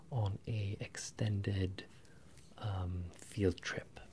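ordinary delivery

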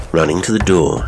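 plain reading voice

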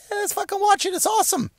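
very high, put-on fanboy voice; mock enthusiasm. Clueless viewers who don't know the difference, mock-thrilled high voice. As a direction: squeal it softly as a delighted, clueless viewer.

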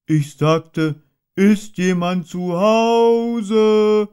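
stretched, insistent shout; very loud call. Acted. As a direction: bellow it down the hole so Rabbit can't pretend. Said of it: "rief Puh sehr laut".

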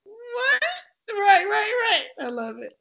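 high, laughing through the words; real laughter breaking through. Real, not acted. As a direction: laugh while answering. Laughing at host's joke.

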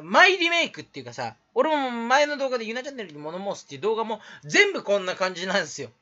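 normal talking voice

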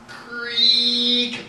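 high, long creaking-door sound; drawn-out creak. A narrator's reading. Narrator imitating an unseen door opening, high creak. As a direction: stretch it into a rising creak.

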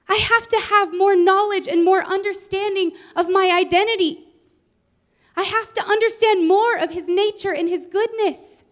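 retold heartfelt outburst; impassioned plea. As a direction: insist through tears that she can't say no.

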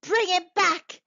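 high, loud command; crying out. A narrator's reading. Old woman demanding her son back, crying out. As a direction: cry it out insistently. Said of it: "cried the old woman".

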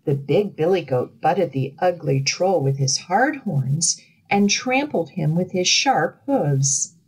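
ordinary delivery

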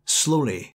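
usual speaking voice

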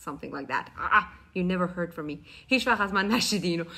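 ordinary talk

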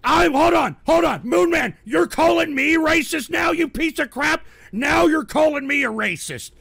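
high, loud indignant yelling; outraged shout. Real, not acted. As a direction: roar back at the accusation. Angry reply to Moon Man.

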